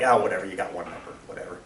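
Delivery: mock bored poker buddies; bored dismissal. Bored friends voiced in story.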